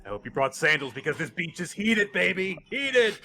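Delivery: building to a loud, high yell; trash-talk it, rising to a shriek; hammed-up taunt. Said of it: "he's like".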